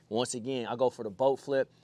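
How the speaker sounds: usual speaking voice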